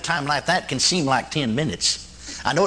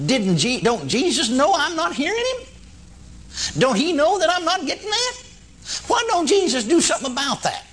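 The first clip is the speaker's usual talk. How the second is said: pleading, higher and near tears; anguished high plea; 10 semitones higher than usual. Retold or imitated. Preacher retells his anguished prayer, voice near crying. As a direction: cry out why Jesus does nothing. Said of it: "Then I thought".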